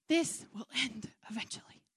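unvoiced whisper to yourself. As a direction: breathe it out as a secret reassurance. Whispered aside.

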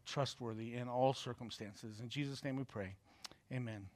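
soft, hushed prayer to God. Acted. Pastor prays softly over the congregation, hushed and reverent. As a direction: pray quietly and reverently over the church.

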